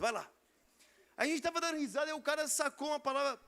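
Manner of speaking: ordinary talk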